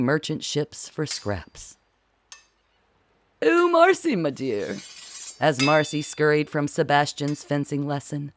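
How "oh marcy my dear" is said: high, fluttery hail from the Duchess; Duchess calling out. Acted. A duchess calling sweetly across the deck. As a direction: sing out her name like a grand lady.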